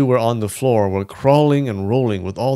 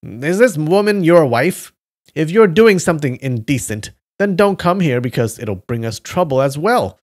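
angry raised voice, higher and louder; 6 semitones higher, 5 dB louder than usual. Acted. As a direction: scold them sharply. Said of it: "he got angry".

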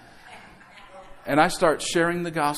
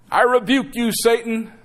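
louder and higher, a hammed-up preacher rebuking the devil; mock rebuke; 6 semitones higher, 5 dB louder than usual. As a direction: cast out Satan with mock thunder. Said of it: "who do you normally think of".

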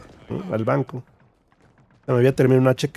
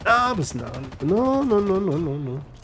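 high, trailing string of denials; imitated high-pitched backpedalling; Germán; 4 semitones higher, 4 dB quieter than usual. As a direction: protest in a put-on higher voice. Voicing Germán's protest.

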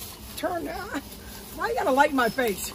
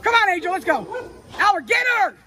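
louder, higher call to the dog; 6 semitones higher, 4 dB louder than usual. Real, not acted. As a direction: call her back before she runs off. Calling the running dog.

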